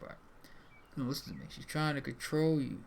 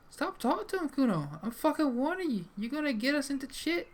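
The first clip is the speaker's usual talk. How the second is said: high-pitched, loud scolding voice; angry warning; 12 semitones higher, 5 dB louder than usual. Acted. Companion warns Kuno off talking to him, high angry scolding.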